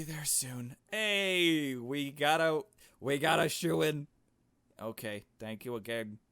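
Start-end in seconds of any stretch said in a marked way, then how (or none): 0.9-4.1 much louder, higher calling out; loud excited call. Acted. Calling out in game.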